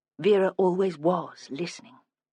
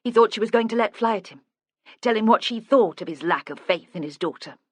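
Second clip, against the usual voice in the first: usual speaking voice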